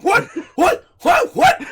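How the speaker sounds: mock disbelief, building to a shriek; what, what, WHAT, climbing in alarm; shocked repeated what. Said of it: "Bernadette was like".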